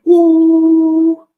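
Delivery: high loud mock machine whine; machine noise. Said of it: "the machine went".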